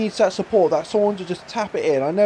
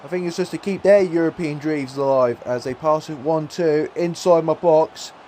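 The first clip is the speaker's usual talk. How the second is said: ordinary talk, nothing marked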